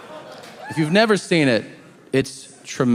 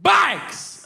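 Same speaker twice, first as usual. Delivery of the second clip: sudden very high yelp; yelled verbal tic. Shown as a demonstration. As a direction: blurt "Bikes!" as a sharp high yelp. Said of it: "I get, like, verbal tics".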